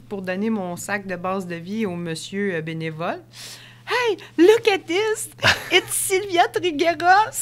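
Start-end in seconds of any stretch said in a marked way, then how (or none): 3.9-7.3 high, loud, slow showy English hype; excited mock announcer. Imagined volunteer hyping her arrival, loud and high. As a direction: announce it like a big star. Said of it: "il me fait comme".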